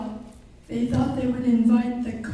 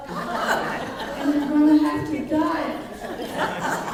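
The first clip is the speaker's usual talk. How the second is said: laughing; 3 semitones higher, slower than usual. Real, not acted. Teller laughs through punchline.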